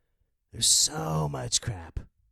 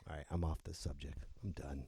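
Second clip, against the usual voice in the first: dropping the subject; 11 semitones lower, 19 dB quieter than usual. Real, not acted. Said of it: "'cause I get angry".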